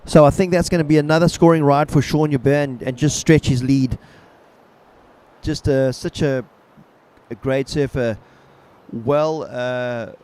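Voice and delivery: laid-back surfer announcer. Acted. Live play-by-play of ride.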